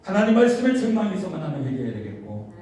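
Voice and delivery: high, loud, driving sermon; preaching at full force. Acted. Pastor exhorting the congregation, fervent and loud. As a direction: preach it out, building fervor.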